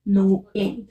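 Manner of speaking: "नोएल" broken into no, el; vowels sounded separately. Shown as a demonstration. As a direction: pull "नोएल" apart into two syllables. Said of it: "इसको ए करेंगे". ("नोएल" को तोड़कर, नो-एल)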